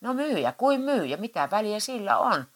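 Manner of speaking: bellowing. Acted. Husband roars that the seller doesn't matter. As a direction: boom it out dismissively. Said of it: "Matti pauhasi".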